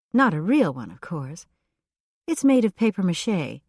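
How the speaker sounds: put-on light, airy, eager young woman; breathy chatty woman. A narrator's reading. Voices Elfrida, breathy and chatty.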